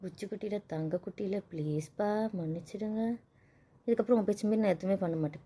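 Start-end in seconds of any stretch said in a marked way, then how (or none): 0.0-3.0 much softer, pet names cooed; soft coaxing. Acted. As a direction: coax him gently out of sulking. Wife coaxes sulking husband. (மெல்லிய கொஞ்சல்)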